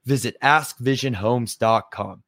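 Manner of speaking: ad read. Acted. Reads sponsor ad.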